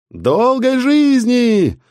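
high, slow drawn-out cheer; joyful roar. Acted. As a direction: roar it like a crowd. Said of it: "ликовала толпа". (радостный рёв толпы)

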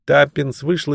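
plain reading voice

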